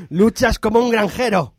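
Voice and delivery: Monkey Island insult sword fight, hammed up; mock insult. Host challenges Alfonso to an insult duel, playful mock taunt. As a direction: toss out the pirate insult with relish. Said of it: "¿te atreverías a responderme a un insulto?".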